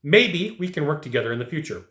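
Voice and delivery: ordinary delivery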